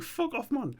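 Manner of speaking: high-pitched mock outrage; mock indignation. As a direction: brush him off in a squeaky, put-on protest. Acted indignant reply to being fobbed off.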